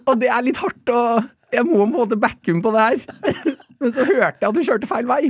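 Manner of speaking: high-pitched, giggly speech; amused and helplessly tickled; laughing confession. Real, not acted. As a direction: talk on through bubbling laughter. Laughing at own mother.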